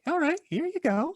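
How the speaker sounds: very high, non-threatening coaxing voice; coaxing a squirrel. Acted. Man luring a squirrel to come, high gentle coaxing. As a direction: coax it like luring a small animal. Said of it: "get, like, a squirrel to come to you".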